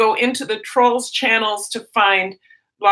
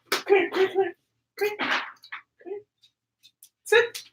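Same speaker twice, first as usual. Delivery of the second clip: talking to a dog; 6 semitones higher than usual. Real, not acted. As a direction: coax the dog in sweetly. Stella wants to come in.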